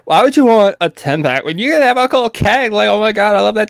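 put-on high-pitched gushing girl voice; squeal-free high Valley Girl chatter; female Valley Girl. Shown as a demonstration. Girlfriend preferring a little keg, high Valley Girl. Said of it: "the good female, like, voice".

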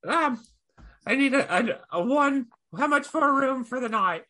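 high, hesitant goblin voice; high goblin voice addressing the barmaid; timid goblin. Acted. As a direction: creep up and ask nervously, pitched high. Goblin asks for a room.

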